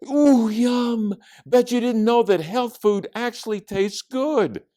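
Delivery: put-on high, loud, gleeful girl voice; delighted. A narrator's reading. Judy enjoys her veggie burger, high and delighted. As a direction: gush over it, pitched high.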